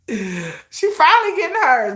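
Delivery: high, slow smug relish; gloating. Pretend. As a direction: savor every word of her comeuppance. Gloating at her downfall.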